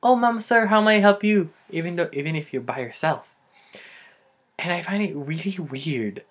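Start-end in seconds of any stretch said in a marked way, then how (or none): 0.0-1.5 high, loud sing-out greeting; perky counter voice, pitched up; Filipino store clerk. Acted. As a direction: imitate an over-eager clerk. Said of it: "they keep saying".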